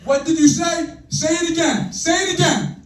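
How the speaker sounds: high, loud furious dad; angry dad. Acted. Dad confronts his teenager, loud and furious. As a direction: confront the kid, daring him to repeat it.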